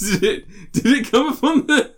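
real giggle through the words; laughing. Real, not acted. Asking a friend about the earthquake, laughing.

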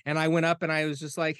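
ordinary delivery

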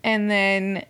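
normal talking voice

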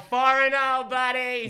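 put-on over-the-top Boston accent, pitched high. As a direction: shout it like a Boston guy across the street. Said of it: "my Boston accent was suffering".

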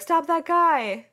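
high, drawn-out mock shout; calling out. Retelling the movie robbery to a co-host, mock calling out. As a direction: imitate someone yelling after a fleeing robber.

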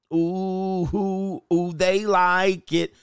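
teasing, long-held oohs; gleeful crowing. Acted. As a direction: stretch it out high, gloating. Crowing that women like it.

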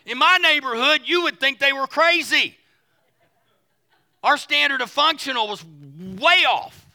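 loud, high-energy pulpit voice; preaching. Real, not acted. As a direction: punch it out to the whole congregation. Preaches at full force.

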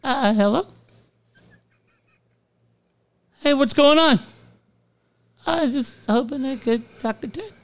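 put-on high, timid old lady voice; old lady caller. Ninety-eight-year-old fan calling Ted's show, high elderly voice. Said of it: "Mary in Monrovia, ninety-eight years old".

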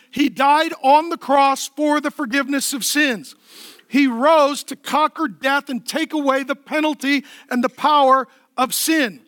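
usual speaking voice

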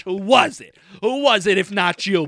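loud retold demand, pressing hard; heated accusation. Retelling the demand to the roommate who interrupts, heated accusation. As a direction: fire the repeated question over the other person.

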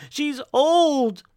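high, loud desperate outburst; crying out. Acted. Brooks defends himself to his wife, crying out. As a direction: cry it out at the top of the voice. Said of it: "cried Brooks".

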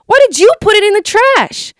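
high, loud hurt scream; tearful scream. Retold or imitated. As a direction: scream at Natalie through tears. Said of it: "I scream at Natalie with tears in my eyes".

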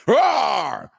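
loud high roar; barbarian roar. Pretend. Streamer acting the barbarian standing over a beaten man, roaring.

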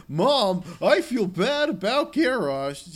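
high, loud hammed-up plea to mom; mock confessing son. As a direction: play a whiny child confessing, exaggerated and slow. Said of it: "closure week on Azeroth, where it's like".

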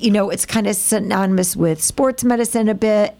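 usual speaking voice

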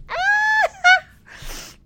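a real, high, loud squeal of joy; excited shriek. Real, not acted. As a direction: shriek with delight at the news. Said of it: "I'm like".

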